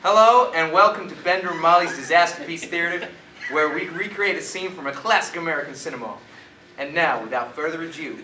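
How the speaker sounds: loud put-on American accent. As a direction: announce it boldly in an American accent. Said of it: "Let's hear you do it American then".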